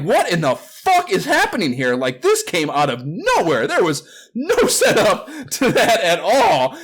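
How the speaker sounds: high, loud mock outrage, dragging out the complaint; incredulous outburst. Reader complaining a romance came from nowhere, loudly incredulous. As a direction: protest in exaggerated disbelief, breaking into a laugh. Replays shocked reaction to the plot twist.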